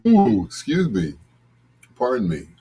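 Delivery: usual speaking voice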